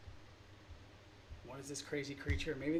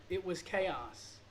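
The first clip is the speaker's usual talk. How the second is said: normal talking voice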